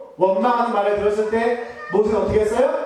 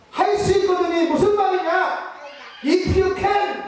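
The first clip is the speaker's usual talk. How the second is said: rebuking shout; 10 semitones higher, slower than usual. Retold or imitated. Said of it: "이렇게 얘기하십니다".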